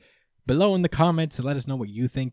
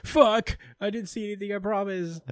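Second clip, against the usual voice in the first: high, flustered mock panic; 10 semitones higher than usual. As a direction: squeal it out like a caught kid. Asta caught holding naked girl.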